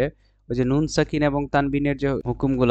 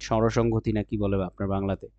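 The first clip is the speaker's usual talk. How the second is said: ordinary delivery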